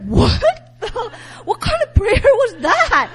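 incredulous laugh. Retold or imitated. After the stranger's odd prayer, high incredulous laughing. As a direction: laugh it off in disbelief. Retold shocked reaction to prayer.

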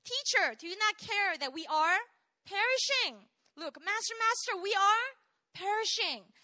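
a hammed-up cry of fear; preacher acting the frightened disciples; mock panicked cry. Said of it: "what's in common here".

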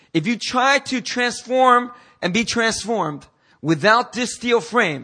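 high, loud, slowed-down warning; full-force preaching. Real, not acted. Preacher warning against building without a foundation, full-force preaching. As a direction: press the warning home with force.

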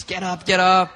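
urgent retold wake-up command; policeman rousing someone. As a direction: play a cop trying to rouse her. Policeman rousing an unconscious girl.

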